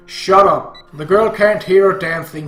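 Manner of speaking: loud, heated snap; furious father in a quarrel; angry shout. Acted. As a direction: shout her down.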